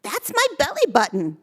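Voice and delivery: lightly voiced little boy, proud of his answer; triumphant child. The boy answers the doctor, triumphant and pleased. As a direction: announce it like a discovery.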